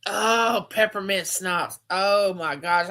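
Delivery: usual speaking voice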